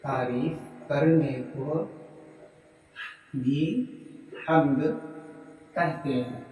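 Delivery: slow, soft word-by-word dictation with pauses. Shown as a demonstration. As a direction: dictate each phrase for students to write down. (धीमे-धीमे, रुक-रुक कर लिखवाना)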